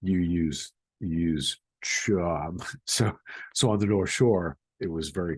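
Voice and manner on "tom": Tom said as "chum" with a Boston vowel; boston accent. Shown as a demonstration. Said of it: "how you pronounce the name Tom is".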